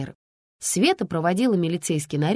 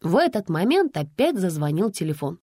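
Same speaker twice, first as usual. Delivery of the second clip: plain reading voice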